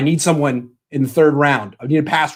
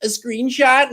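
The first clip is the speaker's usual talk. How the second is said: high, sing-song mock of the helpdesk lady; mocking support agent; 10 semitones higher than usual. Ranting about customer service, imitating the agent in a high mocking voice. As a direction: parrot her sweetly to mock her. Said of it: "and they're like".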